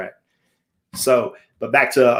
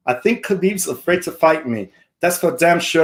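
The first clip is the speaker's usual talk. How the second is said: put-on higher-pitched amateur Irish accent; hammed-up Irish brogue, pitched up; Conor McGregor; 5 semitones higher than usual. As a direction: do your best Irish fighter impression. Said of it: "my best Irish voice".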